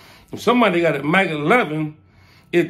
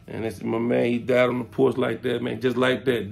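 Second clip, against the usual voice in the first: normal talking voice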